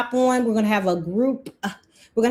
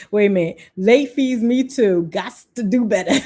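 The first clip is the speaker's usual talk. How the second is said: louder, punchy comment read-out; playful group slang; 4 dB louder than usual. Retold or imitated. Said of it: "We talk like this".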